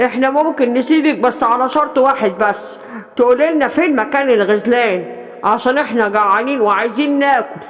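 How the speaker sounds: a hungry predator laying down terms; menacing hyena. Acted. Hungry hyenas threaten gazelle.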